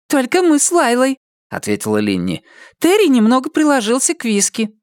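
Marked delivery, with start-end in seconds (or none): 0.1-1.2 high female voice; woman's voice. Acted. Linni voiced by narrator. 2.8-4.7 high female voice; woman's voice. Acted. Linni voiced.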